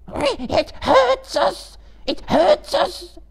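very high, drawn-out shrieking hiss; agonised creature wail, loud and slow; Gollum hissing. A narrator's reading. Gollum tied by the elven rope, hissing in pain. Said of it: "hissed Gollum".

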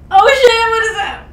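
shrieking high and loud in real fright; real panicked shriek; terrified scream. Real, not acted. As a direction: scream at the jump scare. Jump scare while watching.

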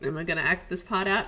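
plain reading voice

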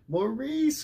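high calling cry for his son. A narrator's reading. Father calling his son from the woodpile, high and loud. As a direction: call the boy's name across the yard. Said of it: "cried Daddy".

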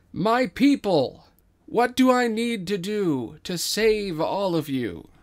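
slow, loud, lofty royal address; regal king. Acted. King addressing his adoring people, slow regal proclamation. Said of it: "King gestures to the audience".

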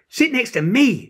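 put-on high, loud, insistent child; insisting. A narrator's reading. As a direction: push her friend into the seat with your voice. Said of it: "insisted Janie".

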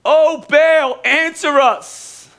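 preacher acts out the prophets shouting to Baal; mock shout. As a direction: call out to Baal like a pleading crowd. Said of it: "They shouted".

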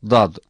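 Dad said thick from the sides of the tongue; Arabic dad demo; thick emphatic D. Shown as a demonstration. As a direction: push Dad out heavy and emphatic. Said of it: "Daima kalın okunur. Telaffuzu zordur".

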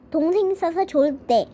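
plain reading voice

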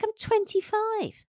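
high, drawn-out indignant protest; retold outrage. Woman retelling her reply to the boss, high-pitched indignant disbelief. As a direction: protest slowly in a squeaky, affronted voice. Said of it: "I was like".

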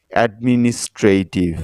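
অ্যাডমিনিস্ট্রেটিভ segmented as অ্যাড-মি-নিস-ট্রে-টিভ; syllable-by-syllable pronunciation. Shown as a demonstration. Teacher shows learners the finished word, অ্যাডমিনিস্ট্রেটিভ carefully syllabified. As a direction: sound out অ্যাডমিনিস্ট্রেটিভ in five distinct syllables. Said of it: "সিলেবলে ভেঙে আলাদা".

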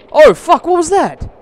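loud, high yelp of alarm; startled shout. Real, not acted. Gamer startled by something sudden, startled shout. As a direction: jump and cry out.

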